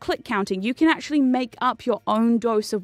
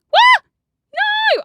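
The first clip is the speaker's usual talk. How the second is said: sudden high yelp, much higher and louder; a real jolt of alarm mid-game; startled outcry; 9 dB louder than usual. Real, not acted. Streamer caught off guard by leaving the match, startled yelp.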